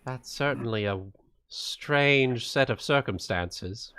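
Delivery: ordinary talk, nothing marked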